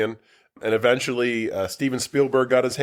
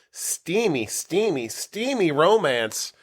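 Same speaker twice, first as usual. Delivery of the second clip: steamy repeated, drawn out slow and higher on the last; teasing emphasis; 5 semitones higher, much slower than usual. Acted. Host teasing the romance movie, repeating steamy in mock sultry emphasis.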